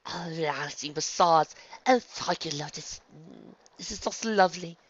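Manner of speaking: high, blissful sigh of pleasure; contented purr. A narrator's reading. Rarity enjoying her massage, purring contentedly. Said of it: "Rarity practically purred".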